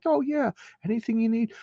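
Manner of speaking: high, soft and friendly mock commissioner; sweet commissioner. Commissioner humoring the cop, high and gentle. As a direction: play the sweetheart boss, lightly. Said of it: "like, a sweetheart".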